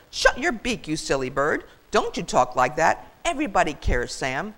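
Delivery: emphatic, swooping reprimand; indignant, punchy scolding; scolding goose. Acted. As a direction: tell him off firmly. Goose scolds self-pitying turkey.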